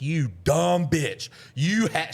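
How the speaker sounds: high, drawn-out mock outrage; retold anger. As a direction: act out an exasperated insult. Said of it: "the worst anger that I've ever had".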